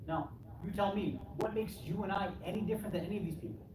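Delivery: ordinary delivery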